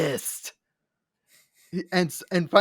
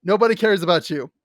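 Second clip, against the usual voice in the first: louder deadpan insult; mock Sphinx put-down; 7 dB louder than usual. Sphinx roasting Scanlan, loud mock deadpan. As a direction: deliver the burn like the Sphinx. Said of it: "He's like".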